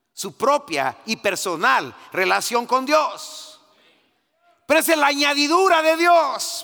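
high, loud fervent preaching; full-force preaching. Real, not acted. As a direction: preach it out to the congregation. (predicación a todo pulmón)

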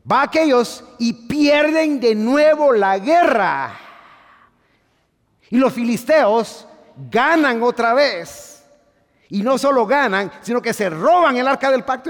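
high, loud preaching; fiery preaching. Acted. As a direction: preach it at full volume to the church. Preaching at full force.

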